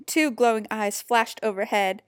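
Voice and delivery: ordinary delivery, nothing marked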